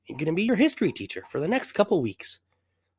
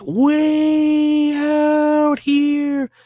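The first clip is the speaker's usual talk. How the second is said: excited; 7 semitones higher, 6 dB louder, much slower than usual. Acted. Teacher dances joyfully.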